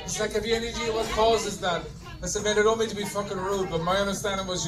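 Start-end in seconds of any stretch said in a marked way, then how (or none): none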